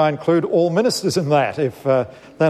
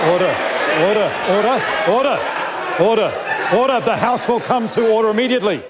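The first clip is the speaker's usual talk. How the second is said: shouting order order; 5 semitones higher than usual. Real, not acted. Speaker calling House to order.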